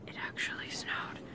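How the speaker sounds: delighted soft whisper. Real, not acted. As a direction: whisper it with quiet glee. Quiet delight at snow.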